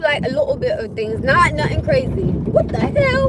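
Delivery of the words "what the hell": high shocked exclamation. Real, not acted. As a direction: blurt it out in shock. Shocked at sight on street.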